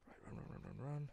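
urgent whisper to himself; hushed, hurried urging. Real, not acted. Sneaking past enemies in game.

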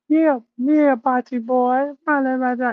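high-pitched mock trash talk; ridiculing, put-on high voice; Three. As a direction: squawk it loud and high in mock patois. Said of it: "That's what it sound like to me".

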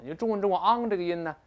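usual speaking voice

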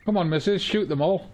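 high, loud excited urging; being chased. Real, not acted. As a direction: yell at the companion to shoot. Urging companion on during chase.

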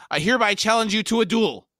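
high, loud duel challenge. A narrator's reading. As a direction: fling down the challenge. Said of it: "He said".

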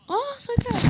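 surprised cry. Real, not acted. A clumsy play in the match.

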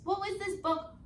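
ordinary talk

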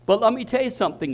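ordinary talk, nothing marked

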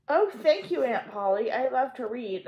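high, gushing thanks; delighted. A narrator's reading. Pollyanna thanking her aunt, high and delighted. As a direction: beam with gratitude.

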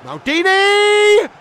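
long, high, loud roar of the name; drawn-out shout. Real, not acted. Streamer cheering his defender's block, drawn-out roar.

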